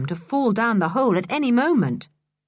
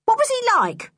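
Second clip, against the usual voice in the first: shrill woman voice; 4 dB louder than usual. A narrator's reading. Missus Stick voiced.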